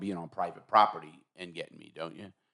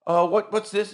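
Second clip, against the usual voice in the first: imitating the judge; 11 semitones higher, 4 dB louder than usual. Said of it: "And she goes".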